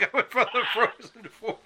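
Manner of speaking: laughing through the words. Real, not acted. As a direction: chuckle while asking it. Host laughs mid-question.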